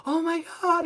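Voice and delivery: high squealing "oh my God"; starstruck squeal. Retold or imitated. Couple at the checkout spotting him, squealing. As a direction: squeal it like a thrilled fan.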